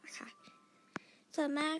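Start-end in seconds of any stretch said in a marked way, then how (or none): 0.1-0.3 trailing whisper. Real, not acted. Voice fading at sentence end.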